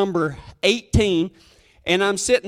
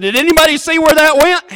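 hopeful call; 7 semitones higher, 6 dB louder, faster than usual. Retold or imitated. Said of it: "and I said".

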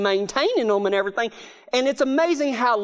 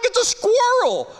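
incredulous mock; 12 semitones higher than usual. Mocking the fireman's bravado.

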